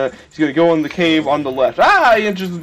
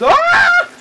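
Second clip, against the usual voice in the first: much higher, louder yelp; sudden shout ah; 7 dB louder than usual. Acted. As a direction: blurt a startled cry. Sudden mock shout mid-story.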